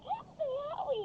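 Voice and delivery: very high, squealed plea; mock cry for help. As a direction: imitate a man falling from a roller coaster, squealing. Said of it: "like, really fat guy".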